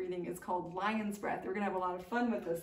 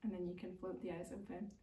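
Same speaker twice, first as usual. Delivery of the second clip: calm yoga teacher guiding the breath; guided meditation; 9 dB quieter than usual. Acted. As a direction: ease them out of the practice gently.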